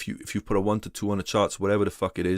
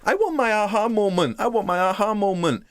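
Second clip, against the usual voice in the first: much higher, whining mock of a needy trader; mocking whiny trader; 9 semitones higher than usual. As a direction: whine it like a beginner begging for a breakthrough. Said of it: "that they're like".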